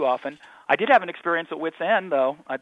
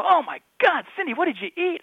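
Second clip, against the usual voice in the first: high, wailing mock horror; hammed-up appalled outburst; retold horrified disgust; 4 dB louder than usual. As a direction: cry out as if hit by a stench. Retold reaction to bad smell.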